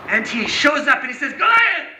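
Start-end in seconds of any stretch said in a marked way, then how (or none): 1.3-1.9 loud, high battle challenge; calling out. Acted. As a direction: shout the giant's name high and hard. David calls out to giant.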